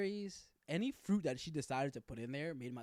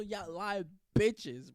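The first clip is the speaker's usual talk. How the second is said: slow, louder mock accusation; drawn-out accusation; 4 semitones higher, 8 dB louder, much slower than usual. Calling out the allergic roommates, slow and loud. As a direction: stretch it out, calling them liars.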